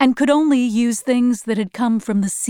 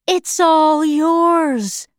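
grand, drawn-out mock offer, pitched up; smiling officer; 5 semitones higher, 3 dB louder, much slower than usual. A narrator's reading. Officer presenting bare ground to prisoners, smiling taunt. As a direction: stretch it out like a gloating host. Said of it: "smiling and lifting his arms wide".

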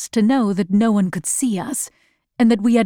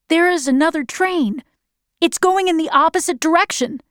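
higher-pitched, eager announcement; excited report; 6 semitones higher than usual. A narrator's reading. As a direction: call out the news from the lookout. Boy reports sighting from window.

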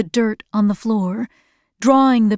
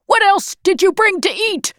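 high, fast, demanding shout; 9 semitones higher, faster than usual. A narrator's reading. Bald man demands food from the girl, shouting. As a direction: demand it in a raised, hurried voice. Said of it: "shouted the bald man".

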